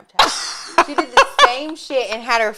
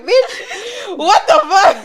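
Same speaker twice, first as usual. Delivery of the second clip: higher and louder, laughing shout of shock; laughing mock yell; 10 semitones higher, 6 dB louder, much slower than usual. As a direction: yell it through laughter at your friend. Retelling shouting at friend.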